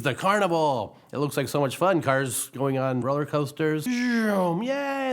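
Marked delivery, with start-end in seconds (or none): 3.8-5.1 high gleeful ride cheer; whee. Acted. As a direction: cheer like a rider on the coaster. Cars on roller coasters.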